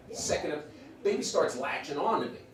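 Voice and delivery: ordinary delivery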